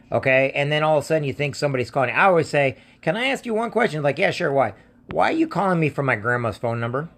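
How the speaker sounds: ordinary delivery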